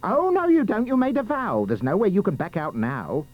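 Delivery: high, scolding sister, dropping at the end; insistent ugly sister. Acted. Ugly sister refusing to release the prince, insistent. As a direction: hold him to it in a high voice.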